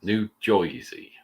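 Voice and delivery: loud Jersey twang on Jersey; New Jersey accent. Pretend. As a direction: stretch Jersey in a thick accent. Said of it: "You love my New Jersey accent".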